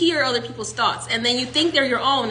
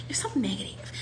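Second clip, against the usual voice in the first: whispering; 11 dB quieter than usual. Acted. Quoted people, whispered aside.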